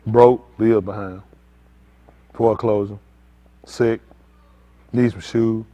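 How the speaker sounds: low, slow, pleading prayer voice, lightly parodied; mock prayer. Preacher mocking prayer for shoes to the congregation, low and slow. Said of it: "let's pray".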